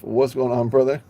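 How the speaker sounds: normal talking voice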